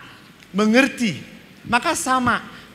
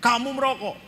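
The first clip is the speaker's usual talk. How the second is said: higher, pressing question from a father; stern father's demand; 8 semitones higher than usual. Retold or imitated. Father confronting his son about the smoking, stern. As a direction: loom over the boy and demand an answer. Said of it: "Bapak saya lebih tinggi".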